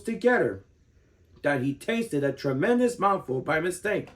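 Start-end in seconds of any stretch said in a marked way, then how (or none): none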